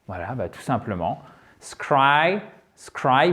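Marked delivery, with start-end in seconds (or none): 1.6-3.3 Scry, scry as isolated stressed syllables, rising; central syllable alone. Shown as a demonstration. Teacher isolates the central syllable for learners, stressed Scry. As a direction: say Scry alone, full eye vowel, lifted at the end. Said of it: "vous partez de cette syllabe".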